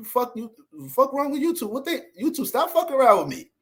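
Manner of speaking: sharp high angry outburst; angry at YouTube. Real, not acted. Ranting streamer yells at YouTube, angry. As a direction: snap at YouTube, pitch up.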